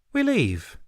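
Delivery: bright, high cheerful announcement; chipper. A narrator's reading. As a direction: toss it off cheerfully. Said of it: "announced chipperly".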